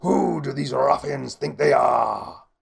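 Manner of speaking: outraged shout. A narrator's reading. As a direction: bellow it as a pompous inquisitor. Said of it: "shouted the Grand Inquisitor".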